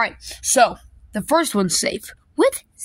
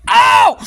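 high loud yelp of pain; 12 semitones higher, 9 dB louder than usual. Acted. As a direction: yelp like it hurt. Pain cry.

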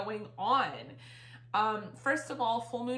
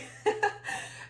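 high breathy laugh; 11 semitones higher than usual. Real, not acted. Laughs at side note.

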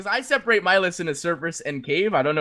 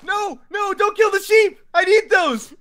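high-pitched wail of protest; frantic pleading; 14 semitones higher than usual. Real, not acted. Player begging rival not to kill the sheep, high-pitched pleading. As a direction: plead in a squeaky, panicky high voice.

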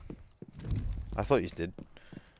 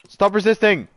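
cop shout; 8 semitones higher, 4 dB louder than usual. Pretend. Mock cop yelling at friend.

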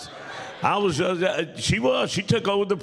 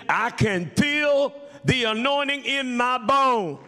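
exulting retold cry; 6 semitones higher than usual. Woman's joyful cry retold in preaching.

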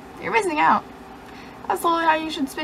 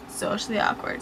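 unvoiced whispered punchline; 6 dB quieter than usual. Vlogger whispering the punchline to viewers. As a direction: drop it to a whisper.

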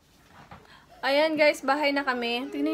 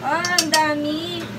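drawn-out, louder "ang dami" at the big pile of food; surprised at the portion; 4 semitones higher, 5 dB louder, slower than usual. Real, not acted. Vlogger shocked by her own huge serving, slow and loud. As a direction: let the size of it catch you, slowly.

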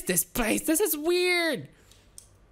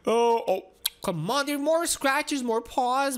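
ordinary talk

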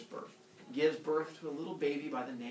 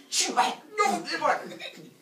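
loud, high mock struggle; hammed-up high burst of noise; frantic gobbling; 8 dB louder, much slower than usual. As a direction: blurt it while pretending to chew. Acts out gobbling second baby.

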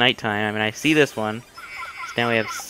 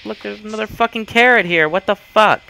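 exasperated shout; 10 semitones higher, 7 dB louder than usual. Real, not acted. Another carrot appears.

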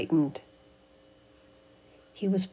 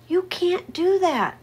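protesting high voice; 12 semitones higher, 5 dB louder than usual. Acted. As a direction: beg them to stop.

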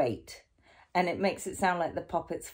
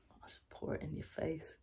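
whisper; 13 dB quieter than usual. Acted. Whispered threat with water.

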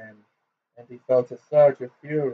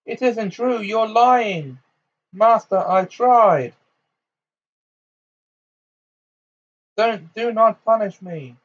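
high, panicked cry; desperate pleading; 9 semitones higher, 5 dB louder than usual. A narrator's reading. Bellatrix begs her master not to punish her, terrified. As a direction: cry out high, begging for mercy.

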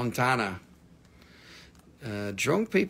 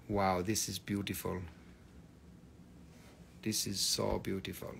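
soft, hushed admiration; awed; 6 semitones lower, 14 dB quieter than usual. Real, not acted. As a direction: quietly marvel at the stone. Admiring a stone softly.

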